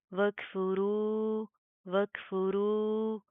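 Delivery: very slow, drawn-out मद in रू; tajweed recitation. Shown as a demonstration. Teacher reciting slowly for students. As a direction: stretch रू long and heavy. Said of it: "तीन से चार अलिफ की limit".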